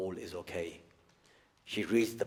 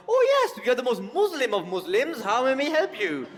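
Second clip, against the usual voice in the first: much higher, louder sing-song put-on voice; mock eager foreigner; 14 semitones higher, 7 dB louder than usual. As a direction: ham up a fawning tourist. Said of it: "niece's genius intervention of".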